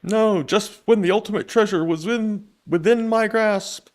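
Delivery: high, slow, frustrated villain; dismayed villain. Acted. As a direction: drag it out in dismay. Villain dialogue read out.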